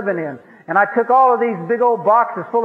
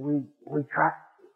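soft, slow, softer-spoken trust; tender; 3 semitones lower, 6 dB quieter, slower than usual. Retold or imitated. Romanians softly tell their helper they trust him, slow and tender. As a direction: say it gently, like a promise.

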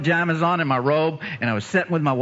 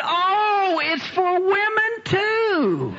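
high, drawn-out comic cry; hammed-up revelation, stretched long; mock exclamation; much slower than usual. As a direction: draw it out high for a laugh. Preacher acts out his own exclamation.

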